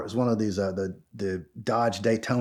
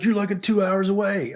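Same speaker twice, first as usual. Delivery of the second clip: retold livid friend; 9 semitones higher than usual. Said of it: "he's, he's livid".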